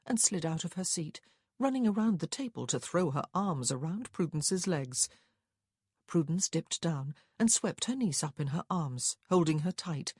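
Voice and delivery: ordinary delivery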